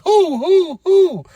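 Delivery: high excited hoo calls; high hooting cheer. Real, not acted. A fan cheering the masked man's reveal, high hooting.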